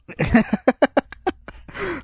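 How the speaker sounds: a burst of high cackling laughter; high squealing laugh. Real, not acted. Podcaster cracking up at his own bottom joke, high squealing laugh.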